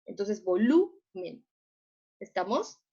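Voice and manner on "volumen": "volumen" despacio, con LU muy marcada, vo-LU-men; énfasis en la tónica. Shown as a demonstration. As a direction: alarga y sube LU en "volumen". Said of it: "suena más fuerte es la lu". ("volumen" recalcando LU, vo-LU-men)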